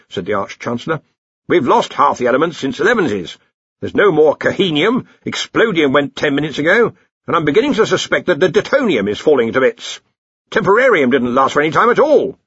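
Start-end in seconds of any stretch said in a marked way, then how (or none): 1.5-12.4 higher, louder grumbling report; Ridcully. Acted. Arch-Chancellor reports the vanishing elements, indignant. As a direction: list the losses with indignation.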